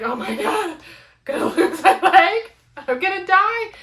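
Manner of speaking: loud, high, half-laughing wail; hammed-up despair, pitch climbing with a laugh in it; mock tearful panic. Runner reliving a seized-up foot, mock tearful panic. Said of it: "I'm, like, holding back tears".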